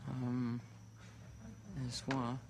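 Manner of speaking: ordinary talk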